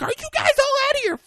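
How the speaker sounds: very high mock-outraged outburst; hammed-up shrill disbelief; acted incredulous outrage. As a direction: screech it as a fed-up girlfriend. Susan's outraged reply.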